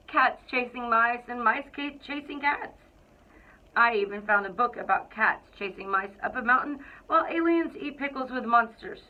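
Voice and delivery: lively, expressive picture-book narration; storybook read-aloud. A narrator's reading. Principal reading to the Cougars, storybook narration. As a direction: read it like you're sharing a favorite book with kids.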